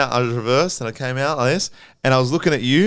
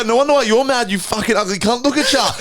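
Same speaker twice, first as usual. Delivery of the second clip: taunting insult; 6 semitones higher than usual. Retold or imitated. Insulting driver in yelling match.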